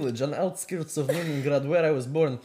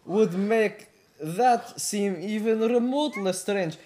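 put-on Russian accent; 3 semitones higher than usual. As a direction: argue the defence in a Russian accent.

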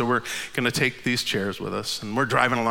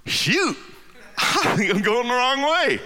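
laughing at the mistake; 9 semitones higher, much slower than usual. Retold or imitated. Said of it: "finally he goes".

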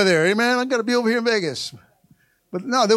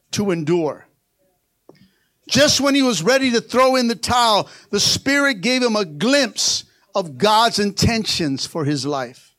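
ordinary delivery

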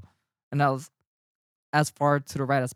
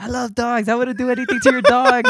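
high mock yell, getting louder; retold yelling; 8 semitones higher, 6 dB louder than usual. Cyclist yelling back at the dog owner, in mock high protest. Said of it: "yelling random things at each other".

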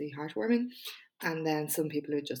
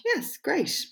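high, loud put-on enthusiasm; mock-cheery reply; 12 semitones higher, 5 dB louder than usual. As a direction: overdo the upbeat stock answer. Acts out her stock cheery reply.

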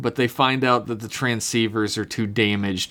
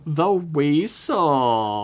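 slow, drawn-out weasel voice; weasel impression; 2 semitones higher, much slower than usual. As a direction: drag out the weasel line slowly. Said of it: "That's the best impression I do".

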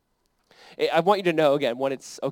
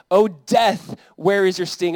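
scripture declamation; 3 semitones higher, 7 dB louder, slower than usual. Acted. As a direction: proclaim the verse with force. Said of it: "That's why Paul says in First Corinthians".

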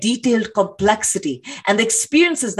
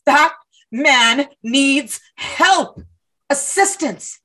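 very slow, word-by-word demand; exasperated emphasis; 3 semitones higher, much slower than usual. Acted. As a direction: spell it out as if to someone not listening. Protester spells it out to cop.

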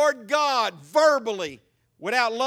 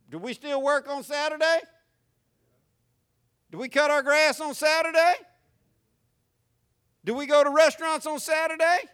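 rising rhetorical questions; 7 semitones higher than usual. Real, not acted. Preacher questioning the congregation.

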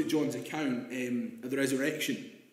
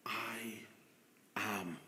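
whispered slowly, lower and much softer; awed whisper; 6 semitones lower, 14 dB quieter, slower than usual. Acted. Jesus revealing himself on the water, reverent whisper. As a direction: breathe it like a holy secret.

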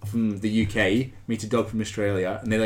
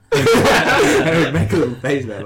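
ordinary delivery

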